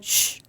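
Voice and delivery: soft unvoiced shh; shushing. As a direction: hush like a teacher quieting kids. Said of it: "when you said".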